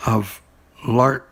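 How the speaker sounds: usual speaking voice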